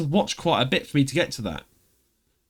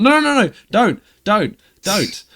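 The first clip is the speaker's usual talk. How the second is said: high, loud panicked pleading; begging; 7 semitones higher, 6 dB louder than usual. Retold or imitated. Begging Beth not to send screenshots, high loud plea. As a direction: beg her not to. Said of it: "I was like".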